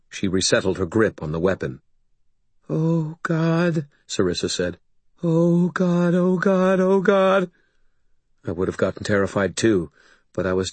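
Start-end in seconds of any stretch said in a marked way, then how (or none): 2.7-3.9 high, dread-filled exclamation; frightened woman. A narrator's reading. Sarissa hearing the fight outside, high and frightened. 5.2-7.5 high, fearful repeating; panicked. A narrator's reading. Sarissa panics as fighting goes quiet.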